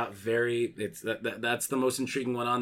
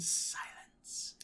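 whispered title; 12 dB quieter than usual. Retold or imitated. Host reading out the nominees, whispering the last film title. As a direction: drop to a slow, hushed whisper. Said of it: "the way he says silence".

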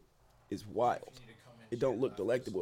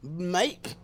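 put-on jokey Australian accent, louder on "Ross"; hammed-up Australian; mock Australian; 8 dB louder, much slower than usual. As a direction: toss off "mate" the Australian way. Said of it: "Fuck little Australian".

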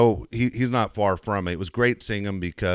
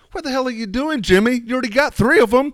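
much higher, loud scolding shout; scolding dad; 5 dB louder than usual. Retold or imitated. Father scolding his son Jimmy over the ball, loud and high. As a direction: yell at your son.

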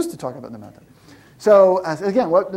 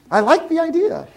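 much higher, lightly voiced customer; mock eager customer; 11 semitones higher than usual. Founder voicing a customer who likes the idea, lightly mocking. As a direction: play the fan who never buys.

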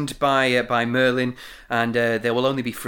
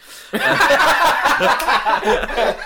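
high, loud burst of laughter; big laugh; 11 semitones higher, 7 dB louder than usual. Real, not acted. Host cracking up over the missed word, loud high laughter. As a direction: crack up loudly at your own slip.

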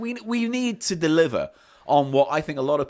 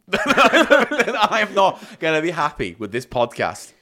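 slow, louder, laughing through it; 5 semitones higher, 6 dB louder, slower than usual. Real, not acted. Host laughs through his joke about the episode. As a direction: laugh the words out.

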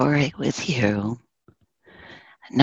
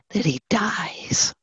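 soft whispered reveal, a touch higher; hushed whisper; 4 semitones higher, 6 dB quieter than usual. Acted. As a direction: let the death fall in a breathless hush. Climax of horse's death.

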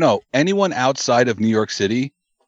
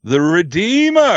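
announcer call; 6 semitones higher, 6 dB louder, slower than usual. Acted. Wrestling return announcement.

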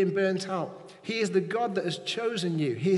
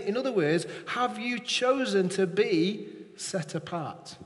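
usual speaking voice

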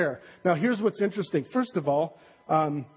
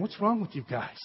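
softer, disbelieving rebuke; a quieter, let-down Nehemiah; Nehemiah scolding; 6 dB quieter than usual. Retold or imitated. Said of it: "And this is Nehemiah saying".

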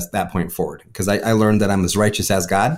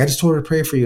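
higher, lightly voiced friend; friend's innocent reply; 7 semitones higher, much faster than usual. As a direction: play the friend as puzzled and innocent. Friend Shelby voiced in story.

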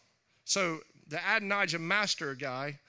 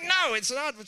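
high, loud quick denial; mock protest; 10 semitones higher, 6 dB louder than usual. A husband denying to his wife, mock protest. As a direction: shoot it right back. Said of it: "I'm like".

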